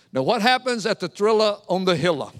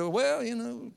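evasive hedging; 9 dB quieter, slower than usual. Retold or imitated. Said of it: "You kinda talk around".

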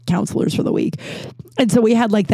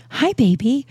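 higher-pitched pet greeting; cooing to a pet; 6 semitones higher than usual. Real, not acted. Owner greeting the cat who came over, cooing. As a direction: coo hello to a cat.

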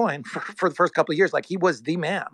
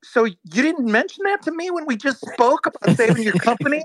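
higher pitched, incredulous question; shocked disbelief; 8 semitones higher than usual. Retold or imitated. Retelling his call with the CEO, higher and incredulous. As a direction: sound stunned that he never said it. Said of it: "in shock".

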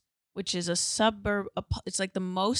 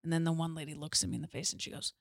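ordinary delivery

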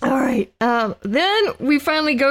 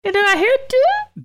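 squeaky high voice; 12 semitones higher than usual. Acted. Voicing the owl line.

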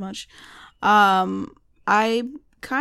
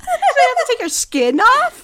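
high, loud laughing question; 12 semitones higher, 6 dB louder than usual. Real, not acted. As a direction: squeal the question while laughing.

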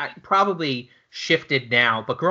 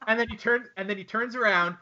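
ordinary talk

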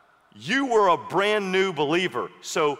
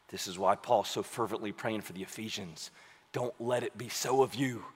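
very soft aside; 9 semitones lower, 9 dB quieter than usual. Real, not acted.

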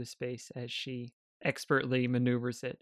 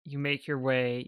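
ordinary delivery

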